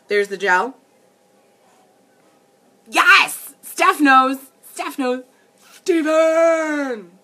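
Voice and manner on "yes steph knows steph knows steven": high, loud playful cheer; cheer, then stretch the name out like the meme girl; excited meme call. Acted. Delighted viewer knows the meme, repeats it.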